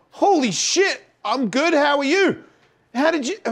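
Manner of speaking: loud, very high starstruck babble; mock overexcited greeting; retold flustered fan. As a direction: blurt it out high, overwhelmed by the star. Retells flustered reply to star.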